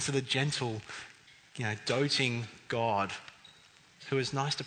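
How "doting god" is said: ordinary delivery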